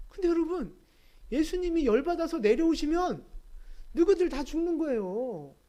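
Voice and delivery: high, emphatic exhortation; impassioned preaching. Real, not acted. Preacher on sinners condemning the living God, impassioned. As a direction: press each point with indignant fervour.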